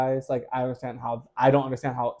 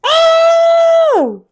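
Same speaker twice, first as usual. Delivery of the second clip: far higher, much louder shocked shout; 13 dB louder than usual. Real, not acted. S0 reacting loud and high to the missing apology. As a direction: blurt out the shock.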